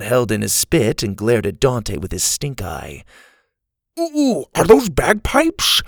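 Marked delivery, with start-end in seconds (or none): 4.0-5.8 high-pitched, drawn-out eager "ooh"; light up with excitement as you ask; excited. A narrator's reading. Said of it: "Grandpa said excitedly".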